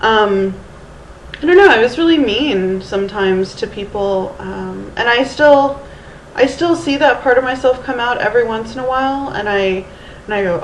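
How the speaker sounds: ordinary talk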